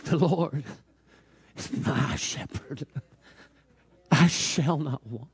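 acted tearful recitation, slow and halting; tearful old janitor. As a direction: recite it haltingly as if choking back tears. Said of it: "some tears began to fall from his eyes".

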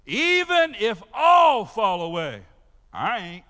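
shouted, slow, defiant boast; Peter's boast. Acted. Pastor voicing Peter's boast to Jesus, shouted and defiant. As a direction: throw the vow out like a challenge. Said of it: "y'all got this?".

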